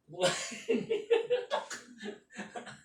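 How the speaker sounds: breathy high-pitched giggle; high laugh. Real, not acted. Laughing along with kuya's effort, high giggle.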